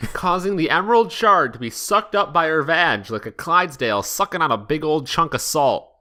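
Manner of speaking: ordinary delivery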